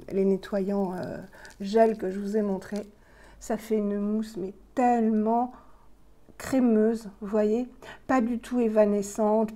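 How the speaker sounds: ordinary talk, nothing marked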